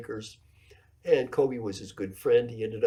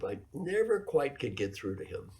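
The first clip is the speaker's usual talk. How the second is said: usual speaking voice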